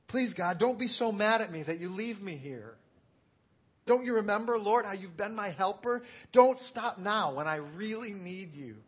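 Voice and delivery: loud, high-pitched desperate praying; acted-out cry for God's help; desperate plea. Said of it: "Do you sense the desperation that he prays?".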